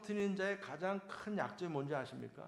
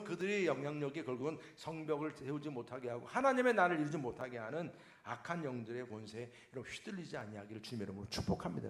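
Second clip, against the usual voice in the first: normal talking voice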